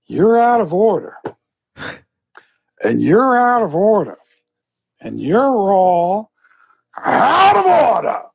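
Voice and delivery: high, drawn-out courtroom rant building to a shout; Al Pacino. Acted. Surrounded by broken washers and dryers, ranting as Al Pacino. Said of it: "as Al Pacino".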